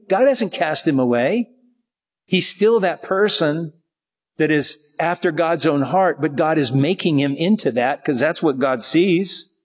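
ordinary talk

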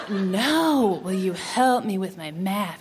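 put-on suggestive boy. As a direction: imitate a boy hinting harder. Boy repeats innuendo again.

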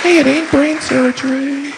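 very high, drawn-out mock voice; dismissive saying. Someone brushing off a task's difficulty, high slow dismissive voice. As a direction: stretch it out slowly, way up high. Acted quote of a stock saying.

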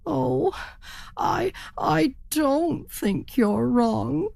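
high, slow, stammered start; dismayed turkey. A narrator's reading. Turkey gives in to the raven, hesitant. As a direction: falter and stretch the words. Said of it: "said the turkey".

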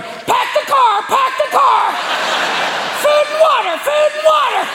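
very high squawking parrot shout, slow; panicked parrot. Acted. Parrot screaming evacuation orders at the owner, squawking. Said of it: "if your parrot starts going".

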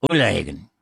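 Ullahagan low, starting on OO without h; Irish pronunciation. Shown as a demonstration. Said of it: "from Irish".

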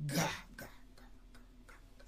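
unvoiced whispered ga ga. Real, not acted. Speaker repeats sounds in a whisper. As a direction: breathe the syllables out with no voice.

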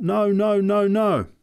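put-on child retelling a sick man's cries, loud and high; child imitating a cry. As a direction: echo his cries loudly to mother. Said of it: "calling out".

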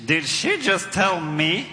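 normal talking voice

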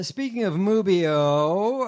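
loud, long drawn-out "Mubio"; comic name mangling. Real, not acted. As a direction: stretch "Mubio" slowly for a laugh. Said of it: "Don't do that when I'm drinking coffee".

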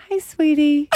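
high, sing-song "Hey, sweetie"; imitating a sweet high phone greeting. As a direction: coo it like a loving wife answering her husband. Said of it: "You go".

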